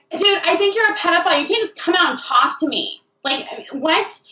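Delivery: incredulous, high protest; high-pitched indignation. Retold or imitated. Protester rebuffing comic outside club, indignant and high. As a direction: sound appalled that they dare approach. Re-enacts outraged reply to comic.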